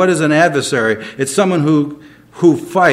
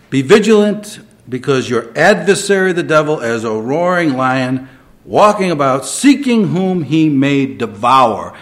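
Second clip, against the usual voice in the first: raised, emphatic scripture call; preaching at full force; 6 dB louder than usual. Acted. Pastor warns the congregation with raised emphatic preaching. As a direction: warn the flock like a prophet.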